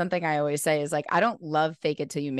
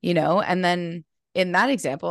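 usual speaking voice

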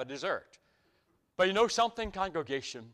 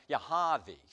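imitated Massachusetts R-less Harvey; Massachusetts accent. Second local confirms his name to the preacher, Massachusetts accent. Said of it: "they do have a different accent".

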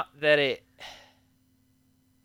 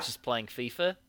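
put-on Kiwi accent; 7 dB quieter than usual. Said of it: "I'm doing a Kiwi accent".

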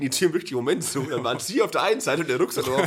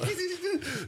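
laughing; 9 dB quieter than usual. Real, not acted. Denies laughing, then laughs.

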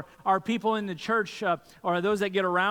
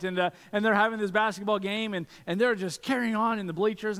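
usual speaking voice

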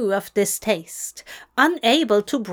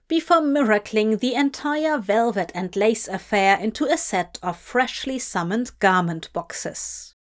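ordinary delivery, nothing marked

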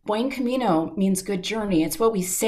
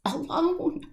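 a raw, pitched-up plea from the edge of a breakdown; retold crying scream; 5 semitones higher, slower than usual. Alone on the trail, pleading to God, retold anguished cry. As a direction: replay the desperate cry at the sky, slow and strained. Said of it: "I was crying and I was screaming like a three-year-old".

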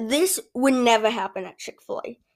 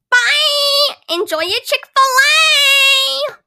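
very high, loud sing-song goodbye; overly cheerful cashier; 8 dB louder, slower than usual. Acted. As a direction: beam a huge fake farewell.

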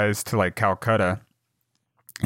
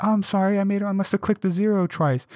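high, put-on scammer voice, mock sorry; fake apology; 10 semitones higher than usual. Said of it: "and they're like".

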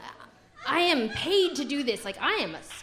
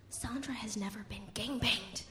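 hushed announcement; 3 semitones lower, 12 dB quieter than usual. Retold or imitated. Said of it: "and she goes, Daisy".

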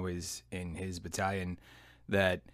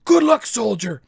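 high, loud sergeant's dismissal; gruff send-off; 12 dB louder than usual. Retold or imitated. Sergeant major sending the soldier off, loud gruff farewell. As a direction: dismiss him with a bark. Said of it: "sergeant major dismissing him".

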